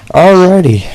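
high, loud, bouncy alrighty; perky alrighty. Real, not acted. Host resetting the show with a high, loud, cheery alrighty. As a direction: sing it out to wrap up the bit.

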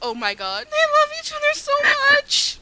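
high, squealing fan gush. Pretend. Fan swooning over the couple, high squeal. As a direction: let it soar up into a squeal. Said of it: "I was just kind of like".

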